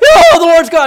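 high, loud, hammed-up crying out; panicked cry. Sam cries out while hiding his bracelet, hammed-up cry. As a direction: overdo the panic. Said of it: "and he's got".

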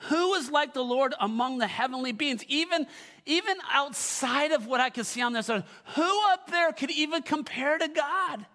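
loud, high, driving preacher's call; preaching at full force. Acted. Pastor to his church, preaching at full force. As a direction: lift the room with a rising shout of praise.

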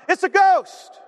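high, loud cry of alarm; acted scream. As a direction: yell it out like terrified men. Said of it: "they scream out".

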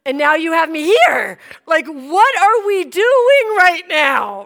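loud, high, drawn-out protest; incredulous complaint. Retold or imitated. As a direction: demand an explanation from God. Retold incredulous complaint to God.

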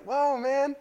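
high-pitched mock surprise; retold startled reaction, pitched right up; taken aback. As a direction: act out being thrown by a rude reply.

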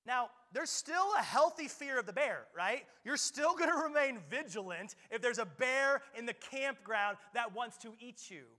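full-force sermon delivery; preaching at full force. Acted. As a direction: drive it home from the pulpit.

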